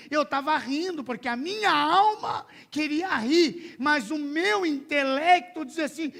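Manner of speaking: loud, high, driving testimony; full-force preaching. Real, not acted. Preacher tells the congregation about uncontrollable laughter, impassioned preaching.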